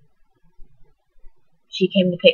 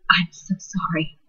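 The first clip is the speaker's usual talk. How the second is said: soft unvoiced whisper, slow; whispered apology; 6 dB quieter, slower than usual. Mother whispering from the hospital bed, slow and unvoiced. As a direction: barely breathe the apology.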